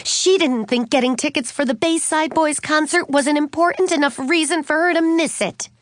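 high-pitched, mildly indignant grievance; angry complaint. A narrator's reading. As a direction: vent to a best friend about Mom. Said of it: "Suzanne told Katie angrily".

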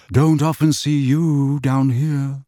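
slow, hollow and grave; a hint of a mournful wizard, drawn out; sepulchral. A narrator's reading. Mournful Bode remarking to Arthur, slow and sepulchral. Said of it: "in a sepulchral voice".

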